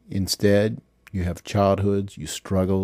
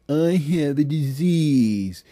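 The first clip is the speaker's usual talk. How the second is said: higher, slow sarcastic mimicry; mocking excuse; 7 semitones higher, slower than usual. Bitter husband mocking his wife's excuse, slow and higher. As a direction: drag out the excuse in a mocking, put-on voice.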